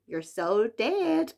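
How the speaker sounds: put-on slow, drawn-out American accent. Reading a book title aloud, in an American accent. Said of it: "say it in that American accent".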